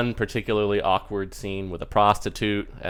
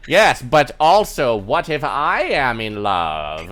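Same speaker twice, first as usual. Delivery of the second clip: slow, loud, swooping delivery; theatrical wizard; 5 semitones higher, 8 dB louder, slower than usual. Acted. Wizard counters with own love, theatrical swoops. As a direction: draw the words out with grand stagey swoops. Wizard puppet makes rival declaration.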